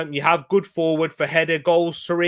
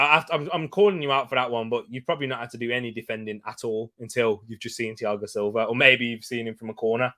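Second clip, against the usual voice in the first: ordinary talk, nothing marked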